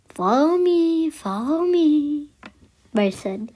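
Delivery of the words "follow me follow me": high, drawn-out sing-out; raised, lilting beckoning call; calling along. Acted. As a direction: call a friend to come along, stretching the words. Character urges friend to follow.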